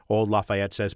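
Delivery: ordinary delivery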